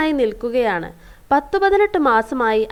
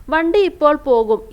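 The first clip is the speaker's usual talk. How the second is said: impatient passenger calling out, louder and higher; 4 semitones higher, 5 dB louder than usual. A narrator's reading. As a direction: shout it over to hurry him along. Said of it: "യാത്രക്കാരൻ വിളിച്ചു പറഞ്ഞു".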